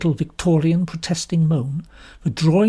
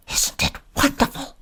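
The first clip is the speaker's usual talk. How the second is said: higher, breathy whisper of thrill; breathed; 9 semitones higher, 3 dB quieter than usual. A narrator's reading. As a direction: breathe it out in awe. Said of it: "breathed a female voice".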